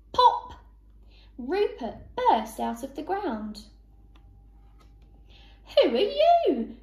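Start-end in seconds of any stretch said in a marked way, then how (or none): 0.1-0.5 high, loud "pop"; sudden pop. A narrator's reading. Rabbit bursts out of the ground, sudden loud pop. 5.8-6.8 high, loud indignant challenge; cross shriek. A narrator's reading. Duck to rabbit who burst up, cross shriek. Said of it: "shrieked Dora crossly".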